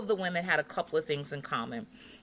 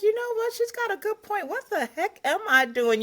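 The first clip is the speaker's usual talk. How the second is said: high, lightly acted inner voice; amused self-talk; 12 semitones higher than usual. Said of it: "I'm like".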